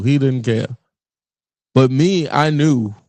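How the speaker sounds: ordinary talk, nothing marked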